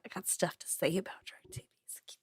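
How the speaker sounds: unvoiced hushed aside; whisper. Real, not acted. Whispering to her cohost to keep going. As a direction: lean in and whisper it.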